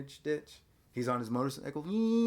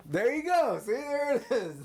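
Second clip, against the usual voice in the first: much higher, laughing delight; 12 semitones higher, 5 dB louder than usual. Real, not acted. Dad cracking up at his son's Arnold impression.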